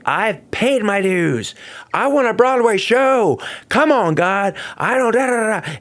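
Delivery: a hint of a pleading rant; ranting at God. Alone in his LA apartment, a mild mock rant at God. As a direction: complain upward, demanding his due. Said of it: "And I'm ranting".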